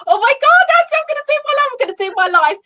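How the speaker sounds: squealing high and fast in mock panic; panicked excitement. Imitating her own panicked squeal to her coach before going live. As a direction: gush it out breathless, way up high. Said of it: "And I'm a little bit panicked".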